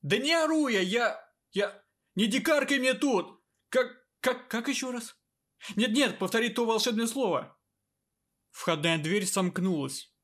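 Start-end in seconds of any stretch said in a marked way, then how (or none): 0.0-7.6 angry shouting. Acted. Emperor keeps yelling on phone.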